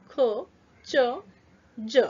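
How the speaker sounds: usual speaking voice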